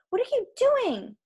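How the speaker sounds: high-pitched protesting demand; exasperated cry. Acted. Annabeth to her friend flattering the giant, high protest. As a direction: demand an answer in disbelief.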